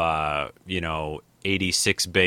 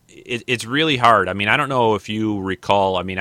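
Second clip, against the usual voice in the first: normal talking voice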